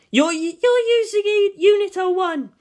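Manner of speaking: high, loud, stammering shriek. Acted. Misato shrieking at Ritsuko in alarm, high and loud. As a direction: shriek in alarm at her friend. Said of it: "she turned and shrieked".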